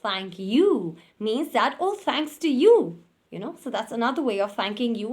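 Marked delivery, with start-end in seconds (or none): 0.0-1.0 "Thank you" with a loud, falling swell on YOU; modulated intonation. Shown as a demonstration. Teacher returning someone's thanks, "Thank you" stressed on YOU. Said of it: "with an intonation and a modulation". 1.8-2.8 "Oh, thanks to you" high and loud, weight on YOU; modulated intonation. Shown as a demonstration. Teacher showing how to return thanks, YOU stressed high and loud. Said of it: "with an intonation and a modulation".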